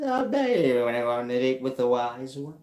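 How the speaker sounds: high, loud mock smooth talker; smooth reply. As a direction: croon it like a ladies' man.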